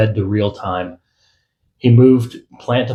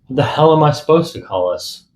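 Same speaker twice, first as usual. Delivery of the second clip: shot back; 6 semitones higher than usual. A narrator's reading. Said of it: "Morgan shot back".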